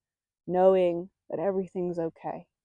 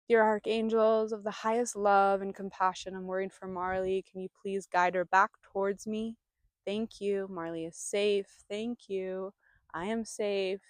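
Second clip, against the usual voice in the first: quiet prayer; 5 dB quieter than usual. Real, not acted. Praying aloud for lost dog.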